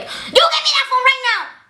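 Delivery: high-pitched mock angry demand; mad teacher. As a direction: imitate a teacher losing it, way up high. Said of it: "she'll be so mad".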